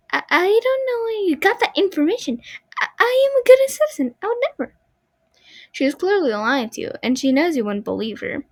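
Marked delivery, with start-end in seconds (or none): 0.1-4.7 put-on high, rising, louder panicked girl voice; panicked denial. Marionette denying the body in a high panicked voice. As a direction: deny it in a high panicked rush. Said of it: "You can hear panic in her voice".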